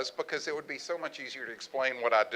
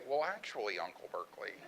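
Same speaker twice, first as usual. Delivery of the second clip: correcting niece; 5 semitones lower, 8 dB quieter than usual. Retold or imitated. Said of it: "and Mary would say".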